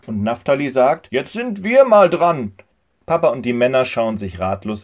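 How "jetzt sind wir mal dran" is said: insisting. A narrator's reading. Farmer insists on turn.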